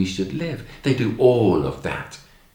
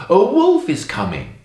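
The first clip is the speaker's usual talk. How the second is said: raised, louder storybook call; acted shout; 7 semitones higher, 5 dB louder than usual. A narrator's reading. Bored shepherd boy tricking the villagers, shouting. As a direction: call out a false alarm. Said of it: "he shouts".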